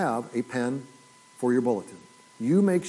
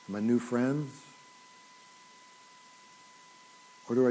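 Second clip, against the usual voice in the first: solemn quiet preaching; 5 dB quieter than usual. Acted. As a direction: let each phrase sink in. Solemn quiet close of sermon.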